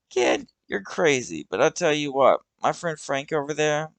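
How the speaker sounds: Douglas's voice. Acted.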